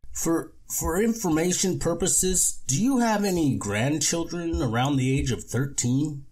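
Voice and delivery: high creepy salesman voice; creepy con man. Acted. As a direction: drop it in sweetly and sinister. Lorne asks about grandchildren.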